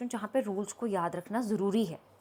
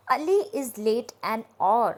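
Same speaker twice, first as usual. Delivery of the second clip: slow demo; 5 dB louder, much slower than usual. Shown as a demonstration. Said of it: "teacher reads example slowly".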